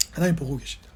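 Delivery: soft, low and solemn; hushed repetition. Real, not acted. Preacher repeating the psalmist's answer to the congregation, hushed and low. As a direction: let it drop low and quiet.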